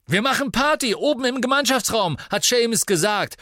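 high, loud joyful yell; exuberant shout. Acted. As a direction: call the party out with glee. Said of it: "schrie Dean ausgelassen".

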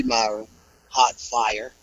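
low, soft taunt of the name; menacing promo murmur. Acted. Wrestler taunting Myron Reed, low quiet menace.

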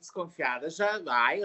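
ordinary delivery

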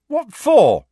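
loud, high angry outburst. A narrator's reading. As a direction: fling it back in protest. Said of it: "said Harry angrily".

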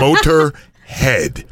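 [name] drawn out slowly and loud; slow, deliberate pronunciation. Shown as a demonstration. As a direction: [name] spelled out syllable by syllable. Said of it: "it's just like [name]".

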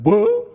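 loud high booing sound; mock crowd booing. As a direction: boo like a disappointed crowd. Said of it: "And the class went".

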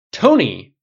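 loud interrupting shout; barked name. A narrator's reading. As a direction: bark the name over his rant. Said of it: "Rhodey barked through his rant".

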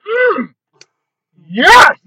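high, loud and slow, cheering the order; hyped-up approval. Retold or imitated. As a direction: hype up the customer. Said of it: "She goes".

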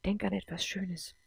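soft, soothing murmur; hushed, comforting suggestion; quiet inner voice. A narrator's reading. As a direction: gently coax from inside the head. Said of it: "Wieder hörte sie die leise Stimme".

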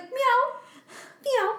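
high mock cat meows; dad meowing. Dad meowing at strangers looking for their cat, high mock meows. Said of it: "He's like".

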